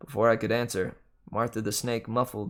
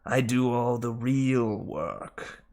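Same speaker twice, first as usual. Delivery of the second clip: very slow, grumbling snake; slow sulky male snake voice; George the snake; much slower than usual. Acted. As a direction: sulk it out slowly.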